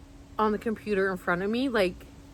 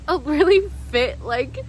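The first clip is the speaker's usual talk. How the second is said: ordinary talk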